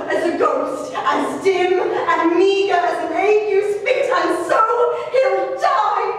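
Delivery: anguished mother pleading, often loud and slow, sinking to near whispers; a mother raging and breaking over her lost son; grieving Constance. Acted. Constance pleads with King Philip and the Cardinal for her stolen son, anguished and desperate. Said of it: "I play Constance".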